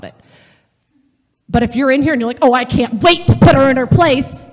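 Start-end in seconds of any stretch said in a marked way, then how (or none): 2.4-4.5 higher and much louder, relishing every word; gleeful eagerness. Retold or imitated. Voicing someone eager to scold a friend: loud, gleeful relish. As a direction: gloat like you can't wait to pounce.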